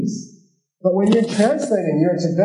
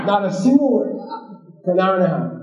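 ordinary talk, nothing marked

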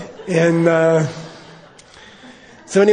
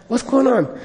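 much higher, eager question; curious Lama; 9 semitones higher than usual. Retold or imitated. Lama asking the priest about confession, eager. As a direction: ask like a wide-eyed child. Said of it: "Lama voiced".

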